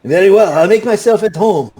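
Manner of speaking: put-on high, loud Puss in Boots style accent; grandly agreeable, in a lilting character accent; Pinto Esteban. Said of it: "This is the same accent I used".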